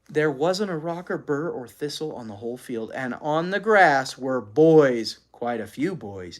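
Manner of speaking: ordinary delivery, nothing marked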